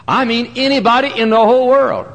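a rising preacher's cry; emphatic. Shown as a demonstration. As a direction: hammer home the whole world.

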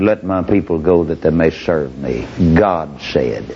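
low, steady, firm declaration; solemn Moses. Acted. Moses answering Pharaoh before the guards, low firm voice. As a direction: lay it down low and unshakable.

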